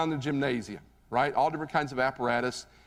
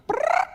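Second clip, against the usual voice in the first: a shrill, loud imitated whistle trill, much higher; whistle blast; 5 dB louder than usual. Coach silences the whole gym with a loud mimicked whistle.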